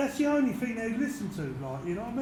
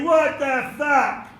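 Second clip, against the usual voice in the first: slow, louder snarled challenge; drawn-out mock confrontation; mock angry challenge; 4 semitones higher, 9 dB louder, much slower than usual. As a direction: spit it out slowly like a threat. Retold confrontation at gunpoint.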